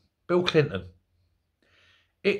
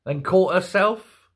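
ordinary talk

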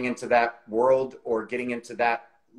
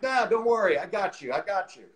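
higher-pitched, breezy salesman reassurance; mock salesman pitch, starting high and easing off; used car salesman; 7 semitones higher than usual. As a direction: imitate a salesman brushing off all doubt. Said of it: "used car salesman approach".

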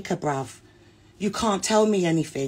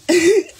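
loud burst of laughter; 11 semitones higher, 8 dB louder than usual. Real, not acted. Burst of laughter mid rant.

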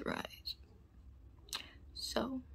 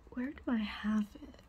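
whispering; 5 dB quieter, faster than usual. Acted.

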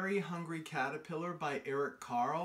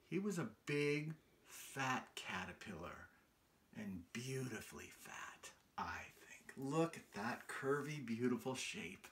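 softer words fading into a hushed whisper on fat; secret whisper; 6 semitones lower, 10 dB quieter than usual. A narrator's reading. Reading to children, sinking into a whisper. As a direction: lean in and whisper it like a delicious secret.

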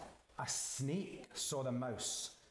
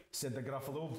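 plain reading voice

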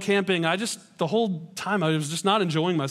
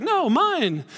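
put-on higher, childish No, mine. as a pouting refusal; mock toddler; 7 semitones higher than usual. Daughter refusing her dad's plea to share, mock whiny. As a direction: whine it like a stubborn little girl.

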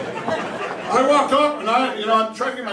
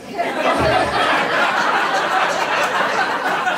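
high breathless burst of laughter; big laugh; 9 semitones higher, 4 dB louder than usual. Real, not acted. Comic laughing at his own story, big laugh. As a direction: crack up at your own joke.

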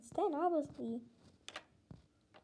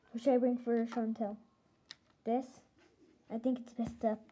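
ordinary delivery

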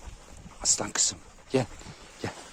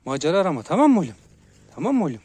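high, loud check-in with a pup; raised-voice pet warning; warning the dog; 6 semitones higher, 8 dB louder, faster than usual. Real, not acted. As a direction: press the question on the dog.

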